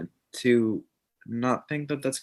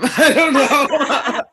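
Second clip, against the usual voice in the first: high, loud, drawn out through laughter; real laughing; 12 dB louder, much slower than usual. Real, not acted. Guest breaks out of her bro act, helpless loud laughing. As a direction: crack up while saying it.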